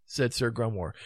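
plain reading voice

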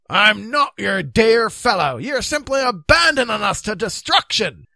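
loud, high, emphatic outburst; furious rebuke. Acted. Knight accusing the king of abandoning them, furious. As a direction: shout indignantly, weighting each word.

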